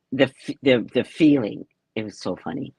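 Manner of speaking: normal talking voice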